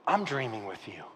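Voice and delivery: low, hushed reassurance; tender encouragement. Acted. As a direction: drop low and soft to reassure. God encouraging, voiced by preacher.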